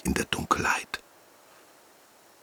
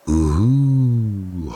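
howling wind; 5 semitones higher than usual. Acted. Wind howling again through dark trees, a lighter hoot. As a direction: howl lightly like night wind. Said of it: "heult der Wind".

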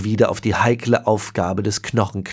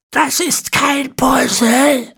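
much higher, slow, strained ancient hiss; hissing old man; 5 dB louder, much slower than usual. A narrator's reading. Old Ezekiel hissing at his great-grandson, slow and high. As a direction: hiss it at him like a creaky old villain. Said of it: "fauchte Ezekiel".

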